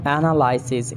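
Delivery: "Analysis" as AN-uh-LIE-sis; long I in "Analysis"; mispronounced analysis. Shown as a demonstration. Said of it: "not analysis".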